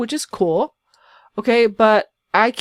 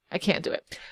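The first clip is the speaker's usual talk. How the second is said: much softer, breathy whisper; whispered aside; 3 semitones lower, 11 dB quieter than usual. Shown as a demonstration. Youtuber muttering to herself about the builder, whispering. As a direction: drop to a whisper to yourself. Whispered repeat of the same line.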